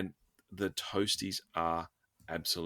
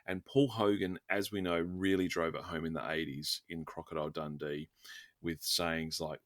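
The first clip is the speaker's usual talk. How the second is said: normal talking voice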